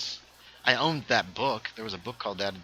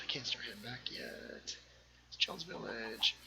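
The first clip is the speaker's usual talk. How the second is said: barely audible, half-whispered thinking aloud; muttered aside; 15 dB quieter than usual. Real, not acted. Streamer checking the time and village to self, muttered aside. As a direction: say it under your breath to yourself.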